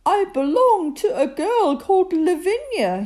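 high, loud, proud toy-bear voice; Bertie's haughty voice. A narrator's reading. Bertie boasting to the bears about his owner, a high, proud voice. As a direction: say it as if it's something grand.

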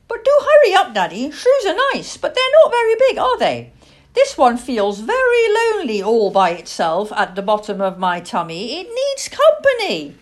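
high, loud little owl voice; excitable young owl, pitch swooping up; eager, hungry owlet. Acted. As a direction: pester daddy in a piping child-owl voice. Plop pesters his father for food.

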